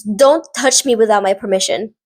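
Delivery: usual speaking voice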